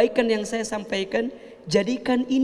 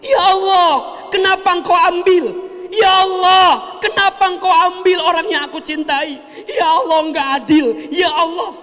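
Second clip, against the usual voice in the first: loud, high anguished wail at God; crying out in protest; 8 semitones higher, 6 dB louder than usual. Acted. Grieving mother wailing angrily at God over her loss. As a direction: cry out bitterly as if cursing fate. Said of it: "pas nangis sambil ngumpat".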